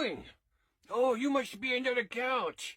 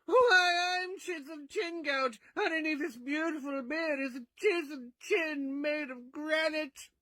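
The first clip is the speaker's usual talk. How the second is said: higher, grand Chiseled Chin Gout voice; heroic Kentucky voice; 4 semitones higher than usual. Acted. The bearded alter ego introducing himself, in a proud put-on voice. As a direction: boast about the granite chin like a pageant hero. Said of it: "Why do you talk like that?".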